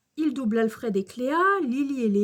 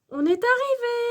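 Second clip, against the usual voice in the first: high, cheery announcement; annonce de maîtresse; 11 semitones higher, 4 dB louder than usual. A narrator's reading. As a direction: announce it to the whole class. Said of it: "annonce la maîtresse". (annonce enjouée, voix aiguë)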